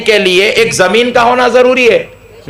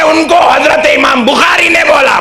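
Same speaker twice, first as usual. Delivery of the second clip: higher, mock-shouted comeback; maulana's retort; 6 semitones higher than usual. Maulana snapping back at the preacher, mock shout. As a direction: fire back the cleric's answer as a shout.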